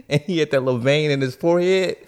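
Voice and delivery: ordinary talk, nothing marked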